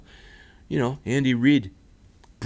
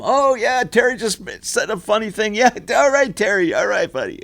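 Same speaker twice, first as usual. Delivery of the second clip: loud, much higher fake-jolly humouring; humouring Terry; 9 semitones higher, 3 dB louder than usual. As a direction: play along with Terry like a patronising colleague. Acting colleagues humouring Terry.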